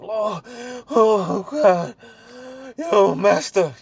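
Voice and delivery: high, loud, frantic cries of mock panic; hammed-up terror, calling for the master; panicked disciples. Disciples crying out to their master in a storm, hammed-up panic.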